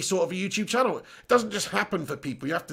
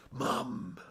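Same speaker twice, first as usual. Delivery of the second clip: low, soft East End growl of "Mum"; a hint of a Cockney hard man calling his mum; quiet gruff "Mum"; Grant Mitchell "Mum"; 7 semitones lower, 11 dB quieter than usual. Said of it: "sound like my name's Grant Mitchell".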